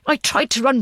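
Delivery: ordinary delivery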